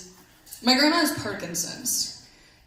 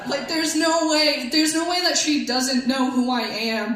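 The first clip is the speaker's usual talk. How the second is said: ordinary delivery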